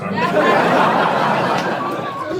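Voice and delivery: bursting out laughing; big hearty laugh; loud laugh. Real, not acted.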